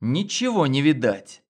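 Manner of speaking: ordinary delivery, nothing marked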